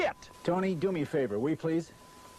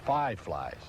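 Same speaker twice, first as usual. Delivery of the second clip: ordinary talk, nothing marked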